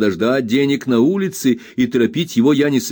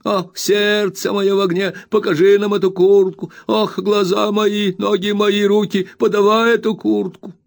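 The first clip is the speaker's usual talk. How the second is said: put-on spiteful whining old man; 10 semitones higher, 3 dB louder than usual. A narrator's reading. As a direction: whine it out spitefully like a crazed old junk dealer. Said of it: "произносил нараспев".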